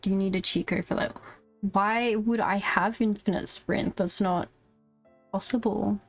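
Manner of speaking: ordinary delivery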